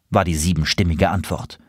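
ordinary delivery

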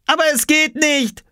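put-on high, frustrated boyish wail; calling back. A narrator's reading. Oskar to his mother through the door, wailing back. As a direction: protest loudly in a high voice. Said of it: "rief Oskar zurück".

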